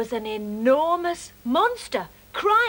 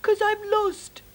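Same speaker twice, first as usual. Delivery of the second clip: slow, mildly sobbing, higher; sobbed miserably; 8 semitones higher, 5 dB louder, slower than usual. Acted. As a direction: drag it out like a lost crying child. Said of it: "sobbed Mog miserably".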